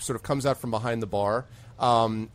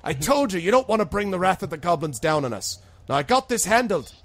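put-on higher, louder furious old woman; angry scolding; 9 semitones higher, 6 dB louder than usual. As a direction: tear into the stubborn fool. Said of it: "She's like".

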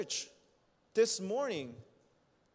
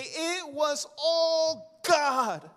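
grateful testimony; 9 semitones higher, 3 dB louder than usual. Acted. Preacher voicing a humble believer, high and fervent. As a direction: lift it up as heartfelt thanks to God.

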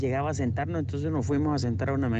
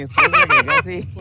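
loud exclaim; 11 dB louder than usual. Real, not acted. Shouting the punchline.

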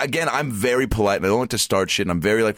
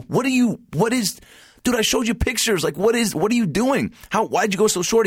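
much higher-pitched incredulous questioning; retold exasperation. Customer confronting barber over short cut, retold exasperation. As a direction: stammer in mock outrage at the barber.

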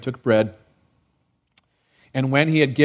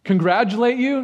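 sarcastic question; 7 semitones higher than usual. Retold or imitated. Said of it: "Paul's like".

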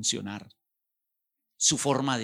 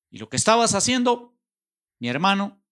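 much higher and louder, a blaring order; forceful shout; 9 semitones higher, 5 dB louder, faster than usual. Acted. The Lord telling Saul his plans change, a high shout. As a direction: announce the change of plans like a new boss.

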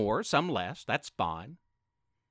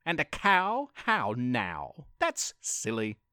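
low, lightly voiced dismissive cat; a hint of a scoffing alley cat; streetwise alley cat; 5 semitones lower than usual. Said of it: "hissed the cat".

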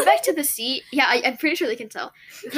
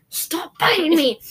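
high, drawn-out complaint; exasperated, slow, higher-pitched outcry; annoyed protest; 4 semitones higher, slower than usual. Real, not acted. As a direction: whine at someone to quit it. Scolding the one biting her.

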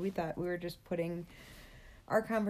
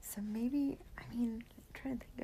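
whispering; 4 semitones higher, 5 dB quieter than usual. Real, not acted. Thinking aloud, very soft.

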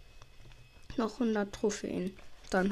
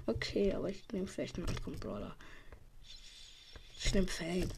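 half-whispered aside; 6 semitones lower, 10 dB quieter than usual. Real, not acted. Quietly picks a brawler.

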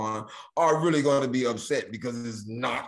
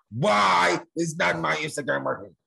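louder, higher outburst at tech support; frantic mock shout; 5 semitones higher, 5 dB louder than usual. Desperate social media addict to tech support, exasperated shout. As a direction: shout it like a hammed-up panicked customer.

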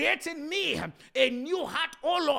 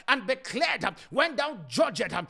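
ordinary talk, nothing marked

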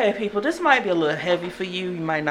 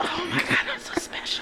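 whispering; 7 dB quieter than usual. Pretend. Mock awe at the new mic.

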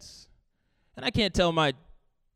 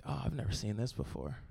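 low, very soft aside; hushed unease; 8 semitones lower, 12 dB quieter than usual. Retold or imitated. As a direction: murmur it as a worried aside, acted.

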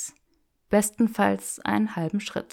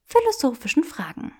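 put-on higher, playful young woman; teasing Hermione voice; 8 semitones higher than usual. Granger teasing Draco in the bookshop, playful and higher. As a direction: tease him with mock refusal.

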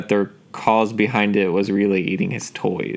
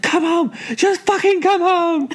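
mock frantic yell. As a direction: squeal the order like the panicked wife. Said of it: "She's like".

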